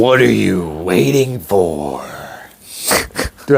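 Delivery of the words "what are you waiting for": slow, drawn-out announcer imitation; deep promo voiceover. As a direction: mimic a monster truck promo voice. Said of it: "get your voice so stupid deep".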